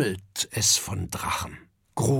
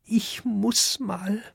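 usual speaking voice

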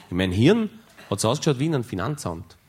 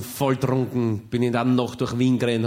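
ordinary talk

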